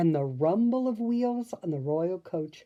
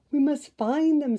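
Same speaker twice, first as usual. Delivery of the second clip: high, loud determined line; firm; 10 semitones higher, 8 dB louder than usual. A narrator's reading. Flora rallying the fairies, firm and loud. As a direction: insist they find them. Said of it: "said Flora firmly".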